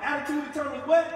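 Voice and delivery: loud, high call to the room; call-out shout. Real, not acted. Speaker rousing the students, calling out loudly. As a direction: shout the question to rally the crowd. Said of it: "get some bass in your voice".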